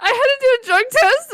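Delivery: high, tearful imitation of a friend; exaggerated weepy voice; mock crying. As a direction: whimper it out, hammed-up. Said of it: "why are you crying".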